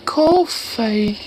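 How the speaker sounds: high put-on echo; mimicking video woman. Mimics woman in played clip.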